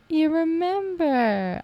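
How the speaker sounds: high, slow and touched, almost cooing; touched and delighted. Retold or imitated. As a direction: sound genuinely moved that they remembered. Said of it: "And I'm like".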